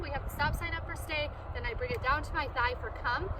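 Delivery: normal talking voice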